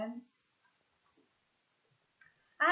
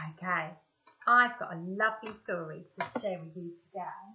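usual speaking voice

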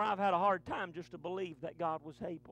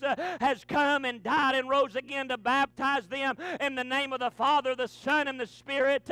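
louder, rhythmic preacher build; preaching at full force; 4 semitones higher, 6 dB louder than usual. Acted. Pastor telling the church of the ascension, preached hard. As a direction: push the story up toward its peak.

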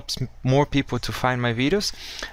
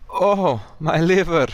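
dramatic lament; 6 semitones higher than usual. Retold or imitated. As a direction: wail it like a heartbroken lover. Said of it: "We don't say that".

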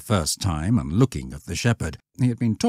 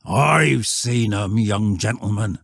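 slow, loud rustic answer; firm old shepherd; 4 semitones higher, 4 dB louder, slower than usual. Acted. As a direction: countryman asserting it solemnly.

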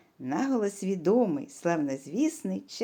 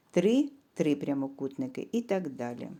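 usual speaking voice

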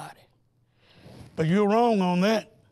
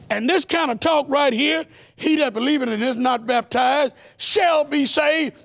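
preaching at full force, higher and driving; fiery preaching; 4 semitones higher, 3 dB louder than usual. Acted. Preacher warning the congregation against snake talk, fiery preaching. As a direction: preach it to the congregation, building fire.

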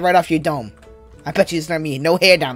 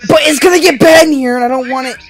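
desperate shout; 10 semitones higher, 7 dB louder than usual. Real, not acted. Pleading to clear the bathroom.

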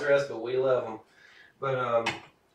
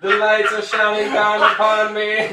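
mock grandiose; 8 semitones higher, 10 dB louder than usual. Mock grandiose reply to praise.

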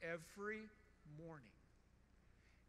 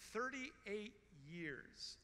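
drawn out slowly, each word weighed; slow stress on "Thirty-eight"; slow emphatic repeat; much slower than usual. Acted. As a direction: let thirty-eight years sink in, very slowly.

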